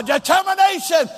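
"determination" echoed high and emphatic; like you mean it. Retold or imitated. Preacher echoing how congregation said it, "determination" high and emphatic. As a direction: call out "determination" with conviction, imitating the crowd. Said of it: "You said it like you mean it".

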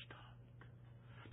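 breathy whispered "stopped". Real, not acted. Preacher whispering the punchline to the congregation. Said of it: "The alarm clock ran down and".

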